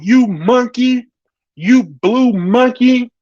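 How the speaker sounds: high, slow mock yell; Erica Mena. Retelling the insult yelled while being removed, as high mock yelling. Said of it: "she yells out".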